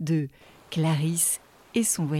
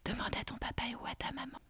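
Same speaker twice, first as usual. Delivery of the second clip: whispering; 5 semitones higher, 14 dB quieter, faster than usual. Acted. Secret tip to child listener.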